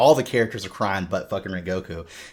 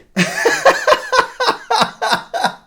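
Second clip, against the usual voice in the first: high, loud cackling laughter; long, high-pitched belly laugh; big laugh; 7 dB louder than usual. Real, not acted. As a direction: burst out in a rapid peal of laughter. Cracks up after handing over.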